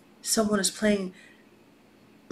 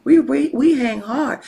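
higher, louder buddy bragging; a touch of street-buddy swagger; lightly acted homeboy talk; 5 semitones higher, 6 dB louder than usual. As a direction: vouch for your dude, loud and casual. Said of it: "I always gotta talk like this".

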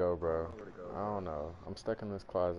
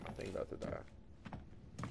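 scared whisper; 11 dB quieter, slower than usual. Real, not acted. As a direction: breathe it out, barely voiced. Scared while hiding in game.

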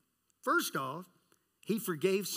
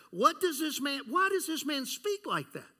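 higher, building to a shouted accusation; rising outrage ending in a shout; indignant scribes; 8 semitones higher, 5 dB louder than usual. Acted. Preacher acting the scribes accusing Jesus, building to a shout.

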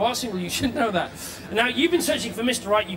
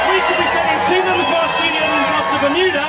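loud TV show announcer; 3 semitones higher, 5 dB louder than usual. Acted. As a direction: hype up the next segment. Announcing next part.

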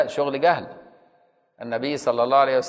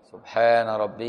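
slow, unhurried tasbih, each word given its time; slowly, calmly; 4 semitones lower, slower than usual. Shown as a demonstration. Teacher showing students the calm way to say it, slow. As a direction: say سبحان ربي gently and slowly, not rushed like calling a cat. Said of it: "لا، تقولها بالراحة كده".

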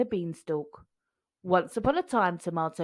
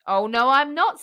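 panto denial; 4 semitones higher, 8 dB louder than usual. A narrator's reading. Panto repeat.

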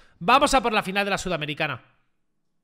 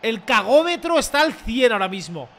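ordinary talk

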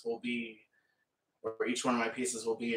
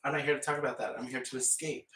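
ordinary talk, nothing marked

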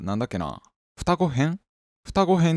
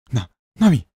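louder, stammered call of a name; sudden loud cry; startled shout; 2 semitones higher, 6 dB louder, slower than usual. Acted. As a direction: blurt the name out in alarm. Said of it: "僕は思わず叫んだ".

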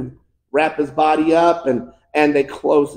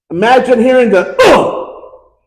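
pained cry; 8 semitones higher, 9 dB louder, slower than usual. Retold or imitated. Imitating the cry on the cross.

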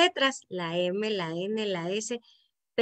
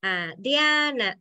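Diana slowed right down, the stressed A drawn out; stress on Diana's A; much slower than usual. Shown as a demonstration. Teacher shows where the rhyme starts, stressing "Diana" on the A. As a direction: stretch the accented A in "Diana". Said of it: "¿dónde está el acento en Ana?".

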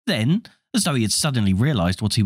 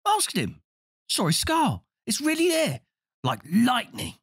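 put-on young boy voice; 13 semitones higher, 3 dB quieter than usual. Twin boasts to mother.

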